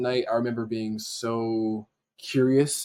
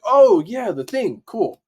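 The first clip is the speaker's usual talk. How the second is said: sudden recollection; 9 semitones higher, 8 dB louder, slower than usual. Retold or imitated. Acts out remembering the form.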